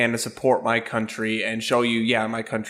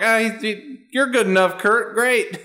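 mock encouragement; 10 semitones higher than usual. Students waving through the singing campus cop, high mock encouragement. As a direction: humor him in a raised voice.

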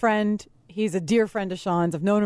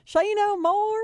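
exaggerated high Southern drawl on the question; Southern accent; 12 semitones higher, slower than usual. Producer mimics callers mishearing a title, mock high Southern accent. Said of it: "everybody who's from the South".

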